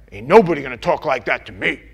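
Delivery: loud, fast indignant rant; mocking a prideful member, loud and quick; proud defiant churchgoer. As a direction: bristle like someone nobody can correct. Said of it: "here's what I get".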